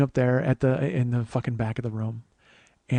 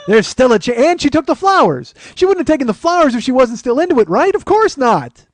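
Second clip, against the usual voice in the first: loud, high-pitched impassioned rant; desperate insistence; 8 semitones higher, 9 dB louder than usual. Acted. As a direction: argue your hopeless case at full volume. Frantically argues she is still interested.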